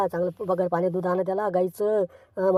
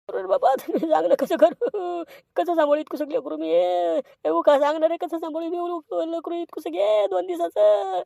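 higher, whining plea for help; a touch of hammed-up despair; pleading wail; 8 semitones higher than usual. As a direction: cry out to them like a helpless father. Helpless father begs for advice.

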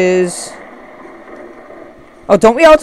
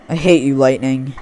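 normal talking voice

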